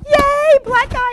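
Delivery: high, loud and delighted; excited cheer. Retold or imitated. Colleague imitating the speaker's relieved cheer on the street. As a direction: cheer like help has finally arrived. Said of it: "'cause you were going".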